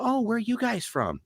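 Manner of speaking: high, lilting curious waiter; surprised waiter. Retold or imitated. Waiter asks the accented diners where they're from, high. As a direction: ask it wide-eyed and light. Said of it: "And they go".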